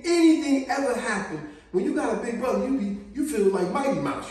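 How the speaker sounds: ordinary talk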